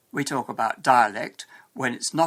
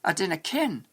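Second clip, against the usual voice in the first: higher-pitched Scots dialect; Scottish accent; 6 semitones higher than usual. Shown as a demonstration. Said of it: "Many Scotsmen say".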